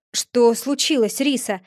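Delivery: ordinary delivery, nothing marked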